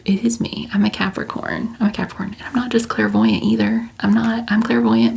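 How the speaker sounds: ordinary talk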